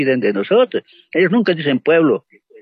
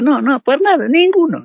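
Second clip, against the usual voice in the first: indignant; 10 semitones higher than usual. Real, not acted. Indignant denial of the people.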